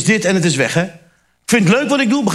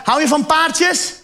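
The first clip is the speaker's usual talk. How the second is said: put-on talking to a child; 7 semitones higher, slower than usual.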